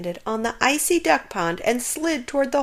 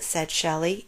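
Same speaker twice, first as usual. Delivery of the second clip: usual speaking voice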